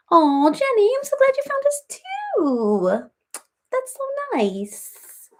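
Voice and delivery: very high, slow cutesy cat voice. Pretend. The cat thanking viewer Jenny, very high cutesy voice. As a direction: speak for the cat, squeaky and sweet.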